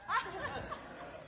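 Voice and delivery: high breathy laugh that trails off soft; short real chuckle, quiet and high. Real, not acted. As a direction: laugh at your own joke. Laughs at his own story.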